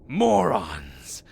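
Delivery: a huge furious yell; enraged shout. Acted. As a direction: let the rage burst out. Hero explodes in rage.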